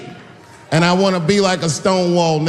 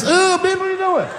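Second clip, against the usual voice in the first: shrill mock outrage, pitched way up; high indignant squeal. Comic to maskless woman at bar, high outraged squeal. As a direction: squeal it like you can't believe it.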